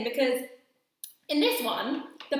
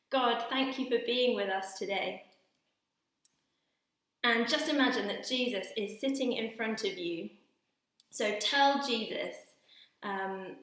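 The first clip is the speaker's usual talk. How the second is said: guided prayer; 3 semitones lower, 5 dB quieter than usual. Acted. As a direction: gently lead a quiet prayer. Leads children in guided prayer.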